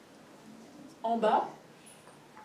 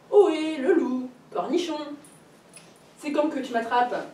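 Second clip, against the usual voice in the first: mocking call, a bit higher and louder; taunting call; 5 semitones higher, 6 dB louder than usual. A narrator's reading. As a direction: tease the wolf from afar. Mère Lappe taunts the wolf. (appel moqueur, un peu plus haut)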